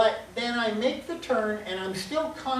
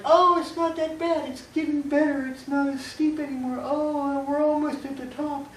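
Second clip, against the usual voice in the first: higher, lighter voice, relieved the climb is easing; relieved climber; 6 semitones higher than usual. Pretend. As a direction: breathe out, sound glad it's getting easier. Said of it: "it's like".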